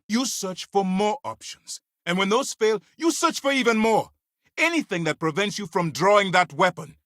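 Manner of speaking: high-pitched, forceful dressing-down; heated scolding. Acted. Commander berating someone for drawing a weapon, heated and high.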